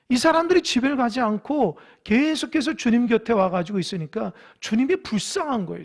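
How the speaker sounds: ordinary talk, nothing marked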